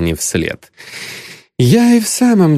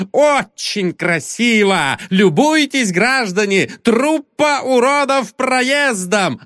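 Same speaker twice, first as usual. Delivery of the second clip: put-on drunk barker presenting a freak show; raised, mocking showman announcing the crowd; booming circus ringmaster; 9 semitones higher than usual. A narrator's reading. Drunk addresses the pub like showman.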